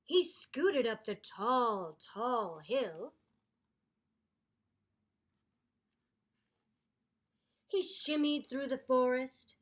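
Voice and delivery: storybook read-aloud voice; story narration. A narrator's reading. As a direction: read it like a picture book for kids.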